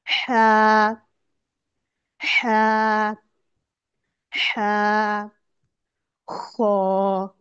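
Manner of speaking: हा and खौ from the throat, crisply; throaty letters. Shown as a demonstration. Teacher drills throat letters for students, throaty recitation. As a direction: breathe हा and खौ from the throat. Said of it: "हलक के शुरू वाले हिस्से से".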